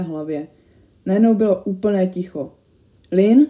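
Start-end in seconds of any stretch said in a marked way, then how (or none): 3.1-3.5 loud questioning call with a rising end; uneasy raised-voice question; fearful call. A narrator's reading. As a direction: call out the name, a touch afraid. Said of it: "zakřičel jsem se strachem v hlasu".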